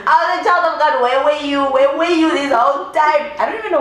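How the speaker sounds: high, loud, giddy praise; excited. Real, not acted. Raving to friends about the rich guy, high giddy gushing. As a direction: gush like he's a blessing.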